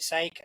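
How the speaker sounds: "Sake" as one syllable, rhyming with cake; preferred pronunciation. Shown as a demonstration. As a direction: say "Sake" like "sayk", silent final e. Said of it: "I call it Sake".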